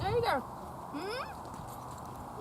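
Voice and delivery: ordinary talk, nothing marked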